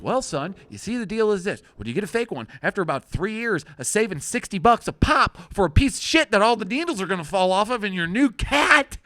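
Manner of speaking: a hint of a lecturing father; lecturing dad. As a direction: explain it like a know-it-all father. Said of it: "I'm like".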